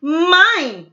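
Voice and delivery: high, loud claim of ownership; snatch the word like a greedy kid; loud, high-pitched possessive cry; grabby shout. Acted. Bernice grabs all the balloons.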